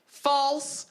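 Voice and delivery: high, sharp verdict call; announcer verdict. Retold or imitated. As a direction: announce it like a gameshow host. Show recap verdict.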